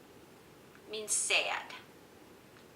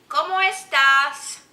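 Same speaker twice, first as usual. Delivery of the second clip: loud, high, slow question; big, stretched question to young learners; teacher quizzing children; 6 semitones higher, 12 dB louder, slower than usual. Shown as a demonstration. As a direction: call it out to the class.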